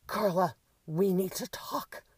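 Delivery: loud, high, haughty summons; imperious demand. A narrator's reading. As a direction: demand it like an offended diva. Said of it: "the crow woman demanded imperiously again".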